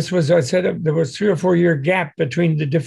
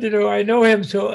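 ordinary delivery

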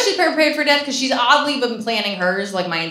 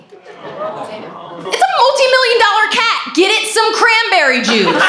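mock outrage; 13 semitones higher, 5 dB louder, slower than usual. Mock outrage at cat owner.

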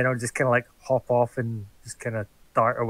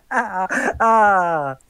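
high laugh; 6 semitones higher, 7 dB louder than usual. Acted. Laughs after own boast.

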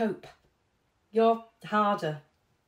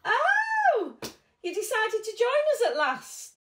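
surprised greeting; 10 semitones higher than usual. Acted. Greeting a late arrival.

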